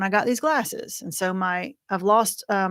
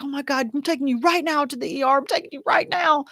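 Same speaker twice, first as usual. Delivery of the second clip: husband's panic; 6 semitones higher than usual. Retold or imitated. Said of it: "he's like".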